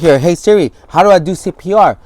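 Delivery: high, loud frantic question to the phone; asking in panic; desperate for CPR help. Retold or imitated.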